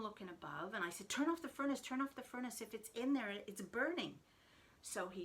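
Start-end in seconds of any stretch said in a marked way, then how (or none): 1.1-2.6 high, loud urgent repeated order; loud frantic order, retold; urgent command. 2.6-4.2 loud alarmed warning; loud worried warning; urgent warning. Retold or imitated. Urgent explanation after the order.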